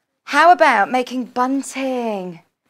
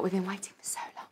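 a hushed, breathy aside, softer; whisper; 4 semitones lower, 16 dB quieter, faster than usual. Real, not acted. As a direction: whisper it like a secret to viewers. Whispered aside, then repeated aloud.

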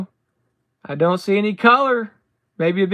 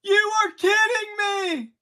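disbelief shout; 8 semitones higher than usual. Real, not acted. Relic pull shout.

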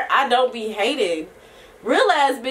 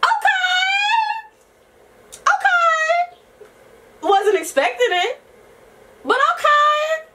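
much higher, drawn-out startled okays; surprised by the dating news; slower than usual. Real, not acted. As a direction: react in squeaky disbelief.

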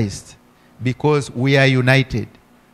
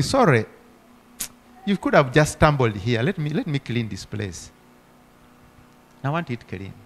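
much softer, kind and gentle; loving reply; 2 semitones lower, 6 dB quieter than usual. Retold or imitated. Believer kindly answering the neighbour who dumped trash, soft and gentle. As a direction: soothe the one who stumbled. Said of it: "act in love and say".

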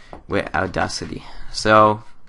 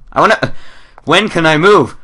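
impatient eagerness; 9 semitones higher, 8 dB louder than usual. Real, not acted. Impatient to start playing.

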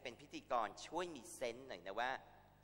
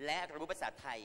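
ภาษาไทย lifted higher and pressed; stress on ภาษาไทย; 5 semitones higher, 4 dB louder than usual. Real, not acted. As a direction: lean hard on ภาษาไทย. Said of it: "stressing the main point".

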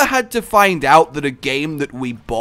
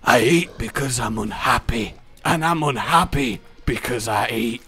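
Fat Bastard; 4 semitones lower, 4 dB quieter than usual. Retold or imitated. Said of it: "go full fat bastard".

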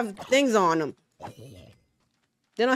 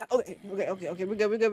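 normal talking voice

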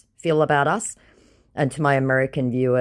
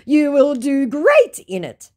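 high, pleading mock voice of the scammer; 13 semitones higher, 8 dB louder, slower than usual. Reading the scammer's message aloud in a high mock voice. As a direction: play the whiny scammer, pitched up.

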